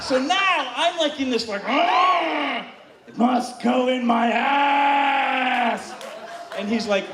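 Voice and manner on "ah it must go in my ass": drawn-out cry, slow and insistent; hammed-up shouted craving; mock ecstatic demand. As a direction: wail it slowly as a desperate demand. Acting self in ecstatic demand.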